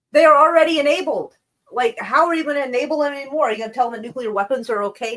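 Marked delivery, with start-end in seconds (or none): 0.1-3.5 much higher and louder, disbelieving; exasperated outburst. Real, not acted. Streamer reacting to Vance's claim, loud and exasperated. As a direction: blurt it out in frustration.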